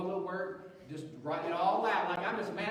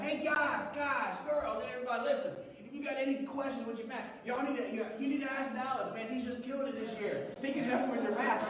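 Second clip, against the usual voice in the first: coach calling out to class; 8 semitones higher than usual. Retold or imitated.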